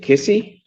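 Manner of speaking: "Kissy" said as KISS-ee, questioning; tentative guess at the name, "Kissy" with a rising end; mispronounced name. Real, not acted. Judge stumbling over a name on the docket, "Kissy" mispronounced as a question. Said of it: "now that I've mangled it".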